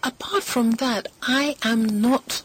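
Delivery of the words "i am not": usual speaking voice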